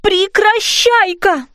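high, drawn-out spell command; crying out a command. A narrator's reading. Fairy mum to the bouncing bed, crying out a command. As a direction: call the spell out high and slowly. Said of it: "закричала мамочка-фея".

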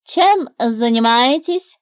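put-on the bird greeting the girl and snake; curious bird. A narrator's reading. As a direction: ask brightly like a nosy bird. Bird's voice.

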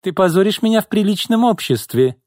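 high-pitched, spiteful outburst. A narrator's reading. Boss orders her secretary off, spitefully. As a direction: fire it back high and angry. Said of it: "злобно произнесла Изольда".

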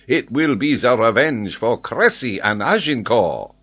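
put-on loud, slow, high French-accented taunt; gleeful French geographer. A narrator's reading. Geographer promises to floor Glenarvan completely, gleeful. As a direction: savour the coming victory with a smile. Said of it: "he began to smile and said".

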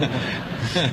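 forced laugh. Pretend. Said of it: "这乐怎么比哭还难看".

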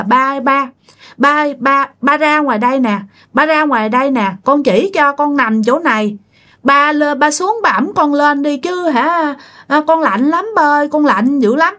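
put-on high pleading child calling her father; possessed child voice. Possessed woman pleading with the baby's father, high and childlike. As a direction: beg like a cold little child. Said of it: "Bà nói rằng á là".

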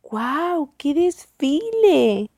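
high, drawn-out amazement; wowed exclamation. A narrator's reading. Storyteller marvels at the parade, amazed. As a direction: marvel at it, stretching the words.

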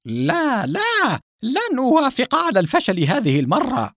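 high-pitched angry refusal; angry, high and emphatic; furious outburst. A narrator's reading. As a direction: explode in outrage, voice climbing high. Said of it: "استشاط القصير ذو الكرش غضبًا".

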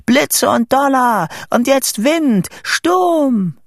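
high, loud, drawn-out summons; shouting. A narrator's reading. Boy summoning thunder and wind, shouting. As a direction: shout slow commands into the storm. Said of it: "schrie ich".

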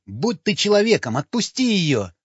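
high-pitched, impassioned plea; urgent appeal. A narrator's reading. Desperate appeal to release the girl. (горячо, с мольбой в голосе)

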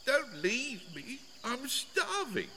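high, soft, slow plaintive voice; small, drawn-out high plea; pleading call. A narrator's reading. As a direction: beg thinly from far away. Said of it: "called Wilbur".

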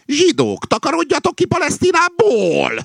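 very high, loud yell; shouted slogan. A narrator's reading. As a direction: shriek it out as a corrected chant. Rooster corrects slogan by shouting it. (magas hangú, harsány kiabálás)

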